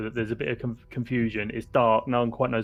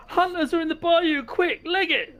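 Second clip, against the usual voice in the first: much higher, louder alarm call; shout; 3 dB louder, slower than usual. Acted. Isaac yelling to the camp to run, much higher. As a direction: yell the warning to flee.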